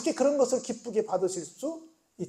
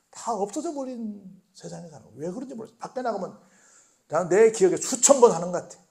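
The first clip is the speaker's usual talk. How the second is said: usual speaking voice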